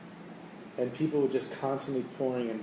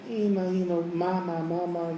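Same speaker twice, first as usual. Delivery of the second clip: put-on crying kids; 5 semitones higher than usual. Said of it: "the kids are screaming and they're crying".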